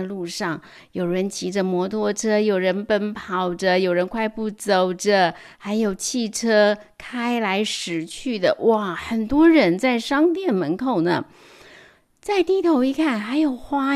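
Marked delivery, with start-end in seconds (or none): none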